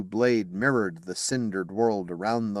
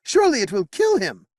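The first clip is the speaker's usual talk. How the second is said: much higher and louder; anguished cry; 12 semitones higher, 7 dB louder than usual. Acted. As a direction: cry out in fear for the king. Knight crying out.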